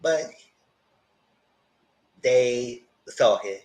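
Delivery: usual speaking voice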